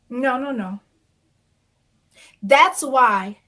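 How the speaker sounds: usual speaking voice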